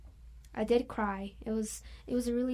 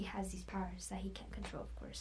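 whisper; 7 dB quieter than usual. Real, not acted. Trailing under her breath.

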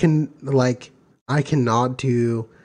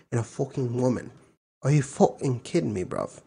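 put-on London street accent, reading aloud; British accent. Reading Urban Dictionary to friends in a British accent. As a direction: read it out in a cheeky British accent. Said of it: "Give me a fucking British accent".

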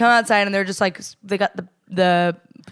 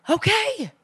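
mom voice; 10 semitones higher than usual. Retold or imitated. Mom quoted, accepting.

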